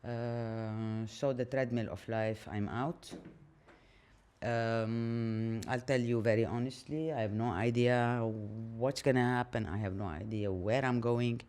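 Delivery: ordinary delivery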